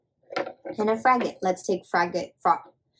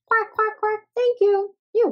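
much higher, louder quacking duck; happy duck; 10 semitones higher, 4 dB louder than usual. Acted. Toy duck voiced happily.

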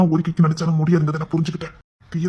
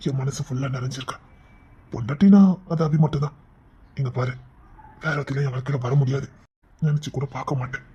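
ordinary talk, nothing marked

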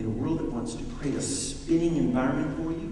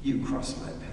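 a touch of defiance; 6 semitones lower, 6 dB quieter than usual. Pretend. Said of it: "Jesus could have been very defiant".

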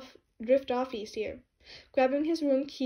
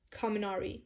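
plain reading voice

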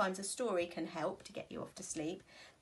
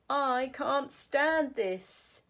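slow exasperated bear; 6 semitones higher, 7 dB louder, much slower than usual. Acted. Same refrain repeated.

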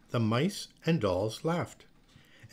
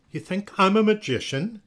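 high, loud, incredulous question; incredulous retort; 8 semitones higher, 6 dB louder than usual. A narrator's reading. Miss Olga to Noelle asking to change roles, incredulous retort. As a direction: scoff at a silly request. Teacher scoffs at the request.

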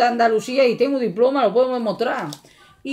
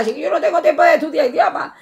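laughing; 5 semitones higher, 5 dB louder, faster than usual. Real, not acted. Laughing about not studying languages.

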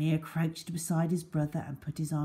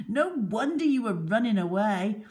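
laughing; 6 semitones higher, 4 dB louder than usual. Acted. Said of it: "Baldhead laughed".

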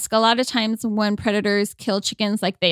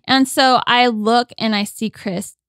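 usual speaking voice

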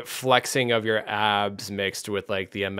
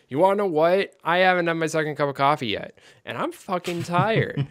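mock grumpy rant; 7 semitones higher than usual. Shown as a demonstration. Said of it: "or you can be like".

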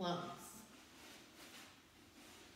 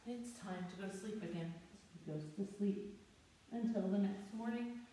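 usual speaking voice

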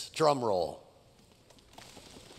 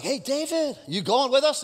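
soldiers calling out; 5 semitones higher than usual. Acted. Soldiers call out to David.

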